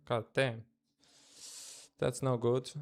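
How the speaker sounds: usual speaking voice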